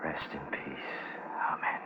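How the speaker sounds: barely voiced, a whispered blessing; soft whispered amen; hushed prayer. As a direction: whisper it over the dying animal. Quiet prayer over dying pig.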